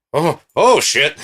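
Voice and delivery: high, loud startled "oh"s; loud mock panic; startled swearing. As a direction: act alarmed and about to bolt. Acts guy on screen startled.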